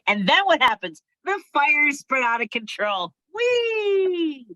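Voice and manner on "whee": high playful whee; gleeful whee. Pretend. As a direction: sing out the fire's joyride. Fire spreading out of control.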